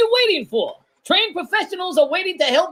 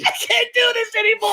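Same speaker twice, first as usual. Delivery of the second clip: panicked wail; 4 semitones higher than usual. Acted. Panicked caller wailing.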